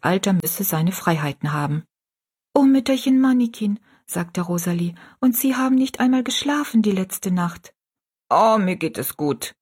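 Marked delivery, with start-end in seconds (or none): 2.5-3.8 put-on young girl pleading. Rosalie pleads with the old woman. 5.2-7.7 put-on worried girl. Rosalie worries about the old woman. 8.3-9.6 put-on old woman waving off concern; lightly voiced little old woman, dismissive; brushing it off. Little old woman brushes off Rosalie's worry, dismissive. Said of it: "wehrte die kleine Frau ab".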